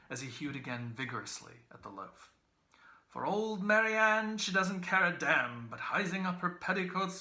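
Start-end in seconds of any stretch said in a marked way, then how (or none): 3.1-7.2 hoarse growl. A narrator's reading. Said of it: "growled in a hoarsened, rasping voice".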